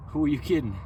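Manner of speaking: ordinary delivery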